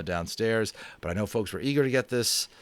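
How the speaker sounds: plain reading voice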